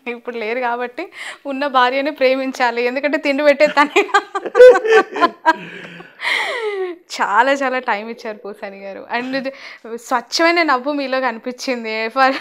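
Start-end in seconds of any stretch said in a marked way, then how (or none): 4.1-7.2 a loud, high burst of real laughter; hearty laugh. Real, not acted. Host bursting out laughing at the guest's joke.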